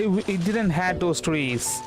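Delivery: normal talking voice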